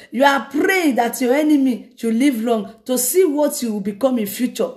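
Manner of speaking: normal talking voice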